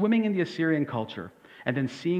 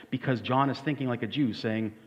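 usual speaking voice

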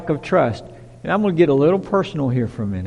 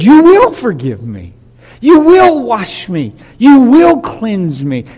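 louder, slow and certain; confident declaration; 5 semitones higher, 7 dB louder, slower than usual. Shown as a demonstration. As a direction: declare it with full faith. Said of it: "He said".

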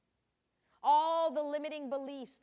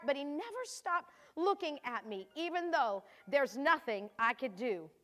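Mary, anguished mother; 3 semitones higher than usual. Acted. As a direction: read it as Mary watching her son beaten. Reading Mary's anguished diary entry.

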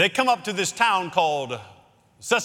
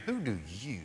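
a much softer "who do you?" spoken to one listener; soft, personal question; 6 semitones lower, 12 dB quieter than usual. Shown as a demonstration. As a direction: bring it down and ask one person directly. Asks the listener in the pew.

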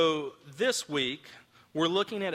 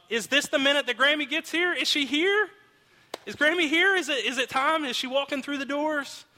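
put-on high, eager child's voice; impatient kids; 11 semitones higher, 5 dB louder than usual. Kids asking dad if Grammy is here, high and eager. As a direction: pester like a kid who can't wait.

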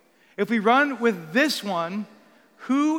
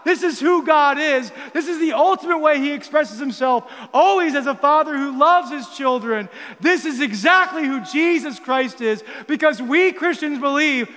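rising to full-force preaching, louder and higher; 4 semitones higher, 5 dB louder than usual. Acted. Preacher proclaiming God's faithful love to the congregation, building intensity. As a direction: preach it with growing fire.

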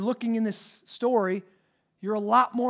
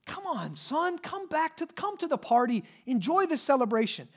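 gentle coaxing; 6 semitones higher than usual. Acted. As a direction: coax him in gently, like a loving dad. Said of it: "gently entreats him".

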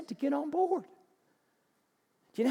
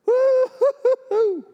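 high, loud, slow hammed-up woo-hoo; mock whooping cheer; 13 semitones higher, 10 dB louder, slower than usual. Preacher mocking church cheering its huddle, hammed-up whoop. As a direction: whoop like a giddy fan.

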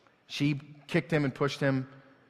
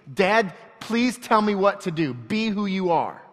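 put-on a child's plea to dad, higher and louder; urgent plea; 6 semitones higher, 5 dB louder than usual. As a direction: plead for dad to take charge.

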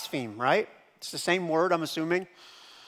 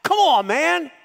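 high, loud jeering taunt; taunting criminal; 11 semitones higher, 5 dB louder than usual. Retold or imitated. Hellbound thief taunting Jesus from his cross, high loud jeer. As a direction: sneer up at Jesus from the next cross. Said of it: "I imagine him going".

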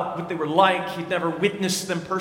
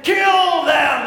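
high, loud bloodthirsty roar; crowd yell; 8 semitones higher, 6 dB louder than usual. Retold or imitated. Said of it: "they kept saying one another".

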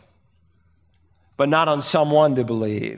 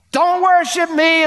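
high, louder protest, stress on worship; sharp raised rebuke; emphatic refusal; 13 semitones higher, 4 dB louder than usual. Acted. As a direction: push it away: don't worship me.

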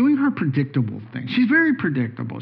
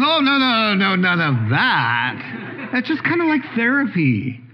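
wife's lively reply; 6 semitones higher than usual. Retold or imitated. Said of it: "And she goes".

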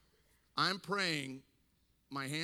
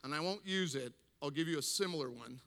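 ordinary talk

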